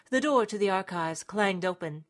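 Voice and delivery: plain reading voice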